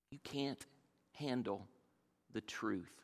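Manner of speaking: soft, half-muttered repeat of the line; quiet echo. Shown as a demonstration. Preacher savoring the line again, softly. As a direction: say it again under your breath. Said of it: "Isn't that a great line?".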